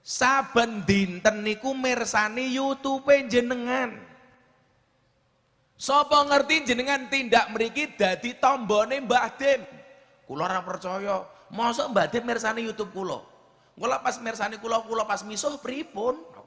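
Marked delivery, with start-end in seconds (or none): 0.0-9.6 higher and louder, slow, imploring; earnest pleading. Retold or imitated. Gus Alam quoted pleading. 11.5-16.4 incredulous retelling. Retold or imitated. Retold own disbelief.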